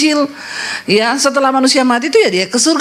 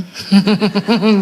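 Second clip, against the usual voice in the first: terkekeh geli sendiri; tawa kecil; 5 semitones lower than usual. Real, not acted. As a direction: tertawa pendek atas leluconnya. Bercanda babi itu harum. (tawa spontan pendek)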